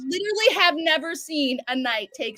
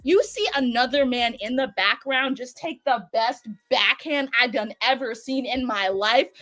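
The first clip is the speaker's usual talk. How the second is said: normal talking voice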